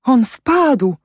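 high, anguished exclamation; moaning.

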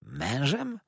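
high, rising question; astonished. A narrator's reading. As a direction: echo the word in disbelief. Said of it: "zawołałem zdumiony". (ze zdumieniem)

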